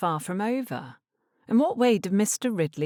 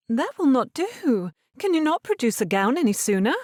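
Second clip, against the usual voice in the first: a hint of an imperious mother; firm mother; 9 semitones higher, 5 dB louder than usual. A narrator's reading. Mamma refuses the fortnight.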